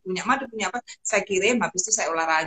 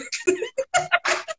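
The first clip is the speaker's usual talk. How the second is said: real high giggle; amused laugh. Real, not acted. Laughs after her dark joke.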